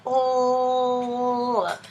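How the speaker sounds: "L." held as lll, tongue out; l sound. Shown as a demonstration. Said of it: "Put your tongue under your teeth".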